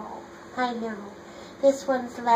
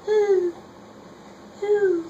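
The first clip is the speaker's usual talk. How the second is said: owl hoot; 7 semitones higher than usual. Acted. Reading to little sister, high owl hoot. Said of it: "He can sound like an owl".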